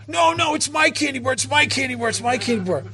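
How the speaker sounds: put-on high-pitched child's protesting wail; stubborn little girl. Little girl refusing to let go of the candy bar, high stubborn protest.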